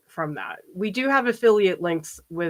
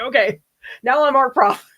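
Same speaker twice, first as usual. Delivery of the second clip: brighter, louder teacher-presenter voice; art prof voice; 5 semitones higher, 5 dB louder than usual. Shown as a demonstration. Showing viewers her professional presenter voice, brighter and louder. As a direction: switch on the on-camera professor persona. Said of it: "It's really easy for me to just be like".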